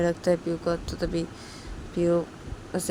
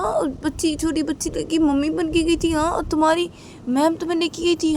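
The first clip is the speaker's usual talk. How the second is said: high, louder incredulous questioning; shocked mother; 11 semitones higher, 4 dB louder than usual. Acted. Mother to her daughter, shocked and scolding. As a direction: demand answers, stunned and upset.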